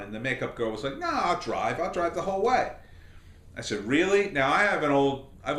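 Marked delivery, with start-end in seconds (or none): 1.0-2.7 eager insistence. Retold or imitated. Makeup girl insists on driving. 3.8-4.3 surprised. Retold or imitated. Surprised she offers to drive.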